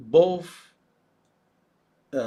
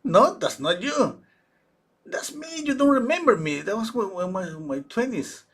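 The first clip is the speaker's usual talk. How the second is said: much higher, insistent no; indignant denial; 9 semitones higher, 5 dB louder than usual. Retold or imitated. Mother insists the portrait is her, indignant. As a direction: correct them firmly, offended. Mother disputes who is painted.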